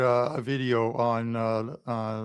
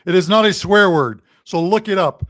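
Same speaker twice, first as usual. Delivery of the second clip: much louder, fast, laughing disbelief; loud insistence; 4 semitones higher, 9 dB louder than usual. Real, not acted. Host insisting to the chat that the dessert is real, loud and fast.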